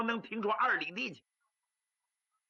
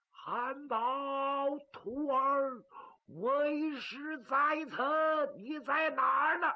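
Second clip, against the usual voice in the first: calling from afar; 6 semitones higher, much slower than usual. Acted. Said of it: "听见有人叫他".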